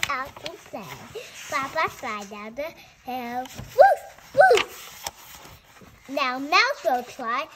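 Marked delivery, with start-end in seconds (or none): 3.4-5.0 high, loud playful barking; excited dog barks. Pretend. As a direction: yap like an excited little dog. Dog barking in picture book.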